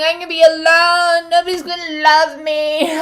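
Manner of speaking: high, loud mock wail; panicking inner child, hammed up; mock panic. Inner child freaking out about being alone, mock wail. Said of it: "everybody's freaking out, going".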